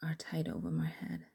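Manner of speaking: breathy intimate whispering; whispered story. A narrator's reading. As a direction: whisper the story close to the mic. Whispered erotic story throughout.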